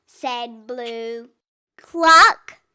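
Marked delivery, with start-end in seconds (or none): 1.8-2.6 loud rising cluck; hen cluck. A narrator's reading. Chicken calls to the truck, loud cluck. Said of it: "said a chicken".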